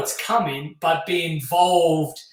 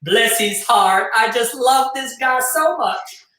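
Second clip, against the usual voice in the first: Southern American accent; 4 semitones higher than usual. Shown as a demonstration. Host welcoming viewers on a dare, country Southern American accent. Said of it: "So you want Southern, Southern American".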